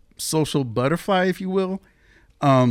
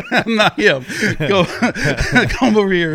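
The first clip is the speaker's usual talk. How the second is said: laughing through quote; 6 semitones higher, 8 dB louder than usual. Retold or imitated. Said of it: "And you're like".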